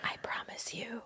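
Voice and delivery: breathy whispered promise; whispering. Retold or imitated. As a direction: whisper it low and secret. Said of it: "I was just like".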